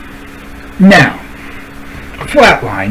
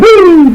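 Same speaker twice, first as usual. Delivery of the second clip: mocking woo; 13 semitones higher, 5 dB louder than usual. Mock cheer at the insult.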